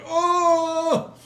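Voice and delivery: high, loud cry of effort; drawn-out acted lifting moan; straining groan. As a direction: yelp while heaving a great weight. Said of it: "like, trying to carry this".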